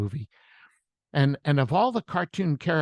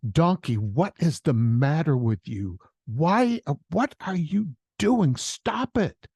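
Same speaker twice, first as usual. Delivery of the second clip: exasperated, rising to a higher pitch; exasperated Shrek; 4 semitones higher than usual. Acted. Shrek questioning his ever-loyal donkey, exasperated and baffled. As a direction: demand an explanation, voice climbing in bafflement. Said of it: "And Shrek says to him".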